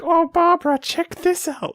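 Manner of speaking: put-on high, far old woman voice; shrill old lady commenting. Old people commenting on Facebook.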